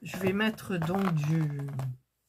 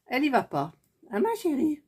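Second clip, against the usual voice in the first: cooing to the cat; 7 semitones higher, faster than usual. Real, not acted. Talking to her cat Indra.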